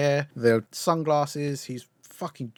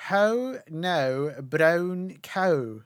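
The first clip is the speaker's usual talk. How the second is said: put-on old lady's Scottish lilt, drawn out; Muriel's Scottish accent; 3 semitones higher, much slower than usual. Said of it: "that Scottish accent sort of thing".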